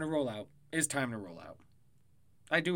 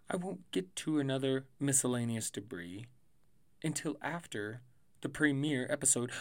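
ordinary talk, nothing marked

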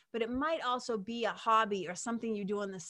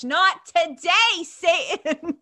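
sassy; 9 semitones higher, 11 dB louder, much slower than usual. Real, not acted. Sassy defiant exclamation.

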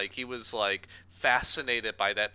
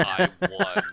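lightly voiced smug nasal villain; a hint of a smug nasal gloat; 7 semitones higher, 8 dB louder than usual. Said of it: "In that nasally voice he does".

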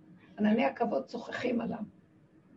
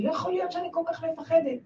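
higher, quickened plea; retold frightened cry; 6 semitones higher, faster than usual. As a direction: protest the fear to God in a raised pitch. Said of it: "התחלתי לצעוק".

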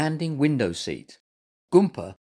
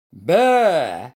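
loud, raised angry roar; 4 semitones higher, 8 dB louder than usual. A narrator's reading. Mister Carr catching Gumper digging, roaring. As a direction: roar it out like an outraged gardener. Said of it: "he roared".

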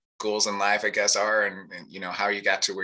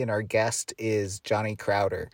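normal talking voice

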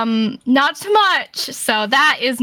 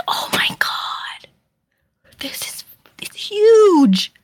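awed whisper; 10 semitones higher, slower than usual. Retold or imitated. Awestruck at Iron Forge.

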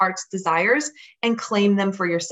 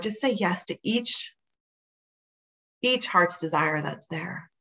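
guided meditation. Acted. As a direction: guide them gently into calm. Leads a visualization.

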